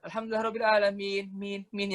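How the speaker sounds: softly fading repeated last syllable; mock echo effect. Shown as a demonstration. Teacher imitating imams' echoing sound, fading echo. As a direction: imitate reverb, letting the ending echo away. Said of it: "efek gema-gema yang kayak".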